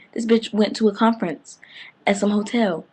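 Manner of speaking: ordinary talk, nothing marked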